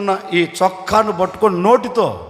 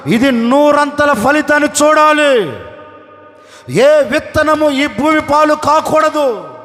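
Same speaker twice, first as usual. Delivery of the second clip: loud, high pleading prayer, building to a shout; tearful prayer; 5 semitones higher, 4 dB louder than usual. Acted. Farmer praying as he sows the seed, shouted plea. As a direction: pray over each seed with all your heart. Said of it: "అని విత్తనం విత్తుతున్నాడు".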